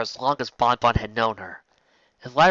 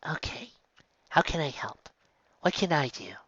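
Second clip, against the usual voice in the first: soft; 4 semitones higher, 6 dB quieter than usual. Acted. Soft continuation after kiss.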